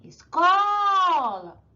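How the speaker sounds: Escola with CO very long, high and loud; "Escola" slowed right down on a booming CO; stressed penultimate syllable. Shown as a demonstration. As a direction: belt out and stretch the CO in "Escola". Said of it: "sílaba mais forte é a penúltima".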